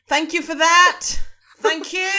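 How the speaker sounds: ऊँची, ज़ोरदार और हड़बड़ाई हुई आवाज़ में; डर के बाद जल्दी से शुक्रिया कहो; डरा हुआ धन्यवाद. Retold or imitated. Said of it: "he'll go".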